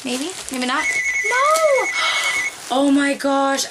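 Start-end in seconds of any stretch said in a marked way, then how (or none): 1.3-1.9 much higher, much louder squeal of "No!"; a sudden thrilled cry of disbelief; surprised shriek; delighted disbelief. Real, not acted. Finding a long-wanted item. 1.9-3.6 thrilled gasp. Real, not acted. Finding a long-wanted item.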